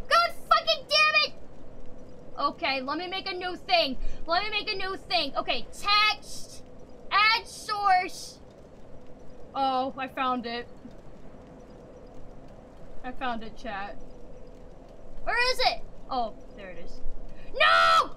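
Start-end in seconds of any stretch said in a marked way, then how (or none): 0.1-1.3 frustrated outburst. Real, not acted. 17.5-18.1 high loud cry of dismay; dismayed shout. Real, not acted. Streamer moving the wrong thing, shouting in dismay.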